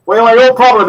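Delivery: put-on loud, high Birmingham accent; thick Brummie accent. Man answering the cook's request, loud thick Brummie accent. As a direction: go big on a comic Brummie voice. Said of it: "brummy accent".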